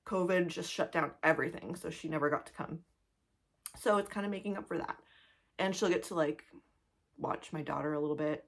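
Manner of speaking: ordinary talk, nothing marked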